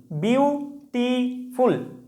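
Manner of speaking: Beautiful slowly split into বিউ, টি, ফুল; syllable by syllable. Shown as a demonstration. Teacher counts syllables for students, saying beautiful syllable by syllable. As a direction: break beautiful into three drawn-out syllables. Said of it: "তিনটা syllable আছে".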